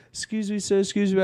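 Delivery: lightly voiced woman asking a stranger for help; polite begging approach.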